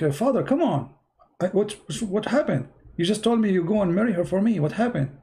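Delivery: high, bewildered son's voice; pleading son. Retold or imitated. Son protests to his father.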